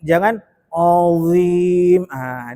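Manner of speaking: normal talking voice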